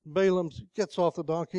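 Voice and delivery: ordinary talk, nothing marked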